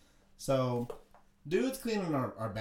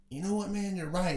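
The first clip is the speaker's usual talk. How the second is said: higher-pitched imitation of sudden agreement; mock won-over; 7 semitones higher than usual. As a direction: lift the pitch as the advice lands. Cleaner accepting the advice, acted out.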